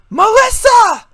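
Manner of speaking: high, loud call of a name; loud high boy's call. A narrator's reading. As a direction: shout her name across the room. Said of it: "called her name".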